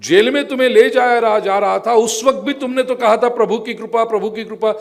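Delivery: high, impassioned acted argument; challenging questioning. King questioning minister about his jail suffering, insistent challenge.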